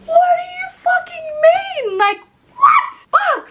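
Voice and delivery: high, slow, shouted outrage; furious yell. Retold or imitated. Reacting to the rejected appeal, shouting high. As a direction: scream it at the screen. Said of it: "And I was like".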